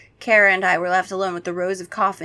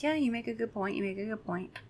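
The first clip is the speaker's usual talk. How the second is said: muttered; 2 semitones higher, 11 dB quieter than usual. Acted. Mocking concession.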